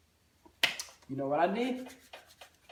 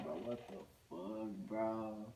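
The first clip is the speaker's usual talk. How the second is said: low hushed dismay; hushed disbelief; 6 semitones lower, 13 dB quieter, slower than usual. Retold or imitated. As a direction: mutter it so mom can't hear. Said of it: "muttered to himself, dismayed".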